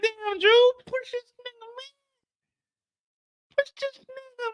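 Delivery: high mocking voice. Narrator mocking the crowd urging Drew to push, squeaky high. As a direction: egg him on in a squeaky high voice.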